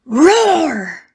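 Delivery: lion-roar on the word. Acted. Narrator voices lion's roar.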